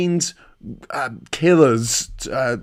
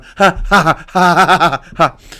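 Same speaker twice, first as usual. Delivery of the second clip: loud, higher gloating cackle; gloating laugh; 4 semitones higher, 6 dB louder than usual. Real, not acted. Teasing rival fan Wayne about beating his team, gloating laugh.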